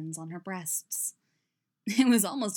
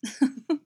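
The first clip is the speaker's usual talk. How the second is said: short voiced chuckle, pitched up; agreeing chuckle; 6 semitones higher, 6 dB louder than usual. A narrator's reading. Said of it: "Fenn chuckled in agreement".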